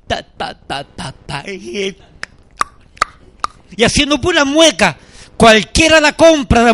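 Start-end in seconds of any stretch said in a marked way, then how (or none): 0.1-2.0 soft, low staccato "ta ta ta"; imitated baby babble. Pastor imitating the baby girl in her crib, soft babble. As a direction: mimic a baby's babbling, very softly. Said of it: "esa muchachita comenzó a hacer".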